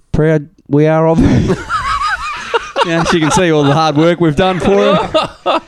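laughing through the words, up high. Real, not acted. Dad laughing while joking about his daughter's mirror. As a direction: crack up mid-joke.

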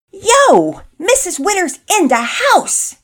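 very high, loud drawn-out whoop; hyped-up cheer. A narrator's reading. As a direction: holler like a boy hyping up the scorer. Boy cheers cafeteria worker's shot.

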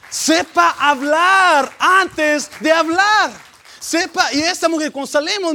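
high, very loud preacher shout; full-force preaching. Real, not acted. Preacher exhorts church to think before speaking, full-force shout. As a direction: cry it out from the pulpit.

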